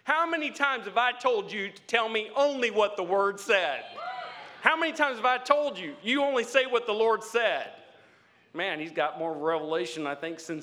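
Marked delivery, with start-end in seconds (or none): 0.0-7.7 louder, higher exasperated demand; exasperated king. Retold or imitated. Preacher voicing the king scolding Micaiah, louder and exasperated. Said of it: "he says".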